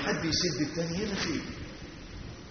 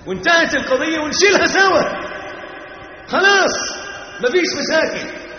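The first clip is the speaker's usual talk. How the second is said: high, loud pulpit cry; preacher raising his voice to stress the lesson; fiery preaching; 13 semitones higher, 10 dB louder than usual. Acted. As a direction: drive the point home to the crowd. Preaching at full force.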